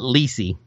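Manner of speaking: "Lissy" said as LEE-see; long "ee" in the first syllable of "Lissy"; corrected pronunciation. Shown as a demonstration. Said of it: "I think they pronounce it".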